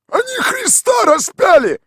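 very high, loud shout; indignant shout. A narrator's reading. Tolik cuts in with a loud indignant shout. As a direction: bellow it out to cut in. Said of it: "вмешался Толик".

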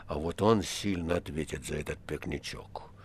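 low, near-whispered threat; quietly. A narrator's reading. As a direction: murmur it menacingly. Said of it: "отозвался Римский тихо". (тихая угроза почти шёпотом)

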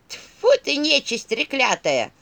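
put-on high, loud indignant old staff; talking staff. A narrator's reading. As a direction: spit it out as a high, drawn-out curse. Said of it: "послышался голос посоха". (возмущённый голос посоха, высоко и громко)

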